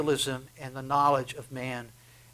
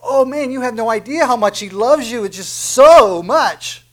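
louder, higher, pleading with fervor; impassioned preaching; 8 semitones higher, 13 dB louder than usual. Acted. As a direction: pour out God's love on the hearers. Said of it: "Just imagine Jesus saying that".

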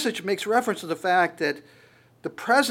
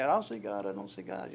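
doubters muttering; 8 semitones lower, 7 dB quieter than usual. Retold or imitated. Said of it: "people are walking around the street".

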